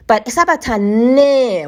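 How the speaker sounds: Sabatane said slowly, leaning on the final ne; Bantu word demo. Shown as a demonstration. Teaching viewers the ne ending, slow Sabatane. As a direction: draw out Sabatane so the ne ending rings. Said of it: "once you add a ne into any word".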